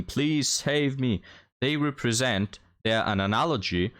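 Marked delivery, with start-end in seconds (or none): 0.0-1.2 drawn-out whining please save me; slow mock plea. Mocking the inmates' slow plea. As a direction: stretch it like a begging inmate. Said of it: "inmates who were shouting".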